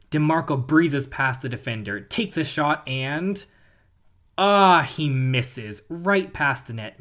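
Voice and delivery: excited play-by-play sportscaster; sports commentary. Acted. Gamer calling the final minute for viewers, excited play-by-play. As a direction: call the tied match like a live announcer.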